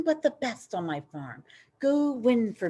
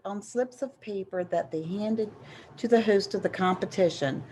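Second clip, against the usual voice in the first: usual speaking voice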